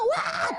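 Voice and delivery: high imitated crying burst; mock child's wail. Child crying harder once mom gives in, mock wail. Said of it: "한 번 울다가".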